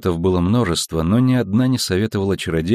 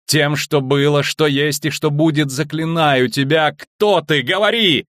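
slow chant climbing to a high cry; impassioned, rising spell-casting; building incantation; 6 semitones higher, slower than usual. A narrator's reading. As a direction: conjure with mounting force, ending in a command. Sorceress casts a commanding spell.

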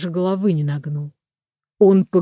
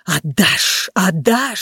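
repeated, sibilant demand of a fire; insistent voice of the flames; roaring flame; much slower than usual. A narrator's reading. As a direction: rush it out like noisy flames. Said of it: "шумело пламя".

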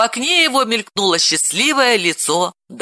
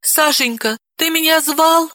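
timid wife; 10 semitones higher than usual. A narrator's reading. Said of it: "жалкая и напуганная".

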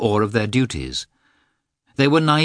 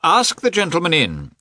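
raised, higher-pitched call; loud voice; 9 semitones higher, 3 dB louder than usual. A narrator's reading. Karenin calling to his servant, loud voice. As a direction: call it out to the next room. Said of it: "he said in a loud voice".

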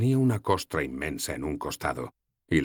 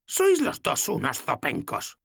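put-on higher, slow old woman; voiced old woman, fondly exasperated; old goddess, fond scolding; 11 semitones higher, slower than usual. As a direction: grumble with affection. Logna voiced.

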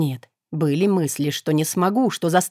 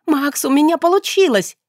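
high, louder joyful cry to Max; overjoyed shout of success; jubilant; 9 semitones higher, 4 dB louder than usual. A narrator's reading. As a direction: celebrate out loud. Heroine rejoices to her pet.

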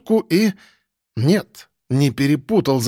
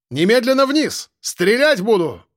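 police shout; 8 semitones higher than usual. Acted. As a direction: bark the order up the ladder.

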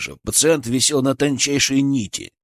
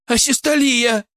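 very high, urgent cry; alarmed yell; 12 semitones higher than usual. A narrator's reading. Colleague sees flatline on monitor, yelling in alarm. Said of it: "заорал Петров".

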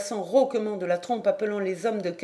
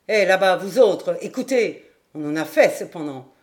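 put-on drunken calling out; 7 dB louder than usual. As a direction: call the whole room to listen, drunkenly. Drunk calls out to the room.

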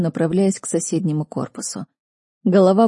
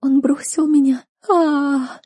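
high, tearful wail ending in a howl; wailing sob; 8 semitones higher, slower than usual. Acted. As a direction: bawl it out, heartbroken. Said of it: "заревела сестра пуще прежнего".

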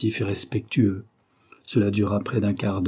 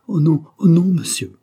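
higher, louder frightened protest; flustered stammer; 7 semitones higher, 7 dB louder than usual. A narrator's reading. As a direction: plead no in flustered alarm. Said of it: "Elle finit par bégayer".

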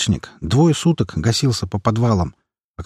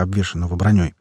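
usual speaking voice

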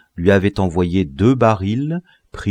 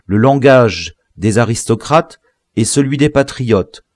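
usual speaking voice

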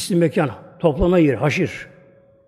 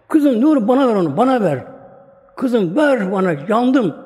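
high, loud desperate plea for water; thirsty man begs a small girl for water; parched begging; 5 semitones higher, 4 dB louder than usual. Acted. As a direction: beg the little girl for her bowl, burning with thirst. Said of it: "su götürüyor bir tasla böyle diyor".

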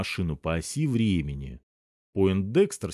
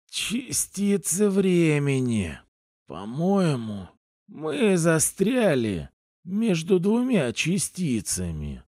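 slow, labored speech; strained effort; 6 semitones higher, slower than usual. Acted. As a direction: force every word out slowly. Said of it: "с мучительным усилием сказал".

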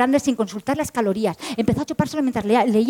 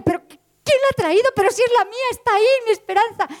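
high, urgent pleading; excited insistence; 9 semitones higher than usual. Acted. Woman insisting to the smiling clerk, high and urgent. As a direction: press him, half laughing.

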